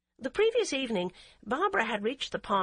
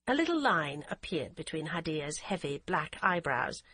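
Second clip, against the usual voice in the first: plain reading voice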